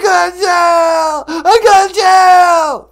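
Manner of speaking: high, loud, hammed-up crying wail; mock Jussie Smollett. Prank caller sobbing to the radio host, high mock wail. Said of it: "Jussie Smollett is on the telephone".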